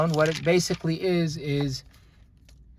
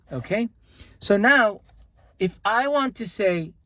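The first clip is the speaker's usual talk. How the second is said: normal talking voice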